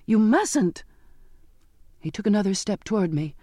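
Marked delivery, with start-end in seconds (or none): 0.1-0.8 higher, insistent protest; frantic repetition, hitting mustn't; desperate plea. A narrator's reading. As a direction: keep begging him to stop. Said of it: "I kept repeating".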